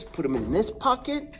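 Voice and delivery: normal talking voice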